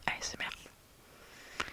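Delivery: "A S M R" whispered slowly as separate French letters; "A S M R" in French letter names, unhurried; spelled-out letters. Shown as a demonstration. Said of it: "je préfère le dire en français".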